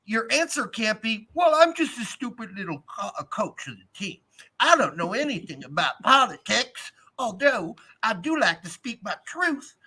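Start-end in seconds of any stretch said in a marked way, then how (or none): 1.3-9.8 mock Southern accent, pitched up; muddled Southern accent. Podcaster mimics the coach playing dumb, muddled Southern accent. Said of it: "I don't even know what accent that was".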